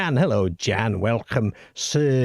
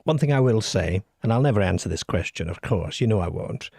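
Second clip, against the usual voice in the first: usual speaking voice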